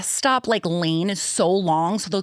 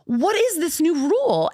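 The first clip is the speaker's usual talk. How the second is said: exasperated protest; 10 semitones higher than usual. Retold or imitated. Mom arguing with the cop, high disbelief. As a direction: whine it in disbelief. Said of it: "And I go".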